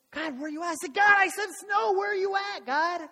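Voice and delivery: high, loud demand to the sky; acted tantrum, building to a shout; impatient kid. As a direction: yell up at God like a fed-up kid. Impatient kid yelling at God.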